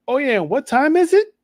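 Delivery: a hammed-up Chilean accent on the question; imitating Stephanie Vaquer's accent. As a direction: tease her with a playful imitation of her Chilean accent. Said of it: "In her accent and everything".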